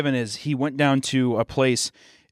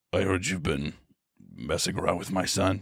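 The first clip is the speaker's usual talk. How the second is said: low, quiet menacing teacher voice; menacing Walter; 5 semitones lower, 6 dB quieter than usual. Retold or imitated. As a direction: lean in low and threatening. Walter threatening a student.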